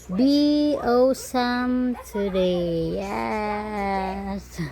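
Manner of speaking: stretched out slow, bright cheer; slow encouragement. Real, not acted. Cheering on the baby.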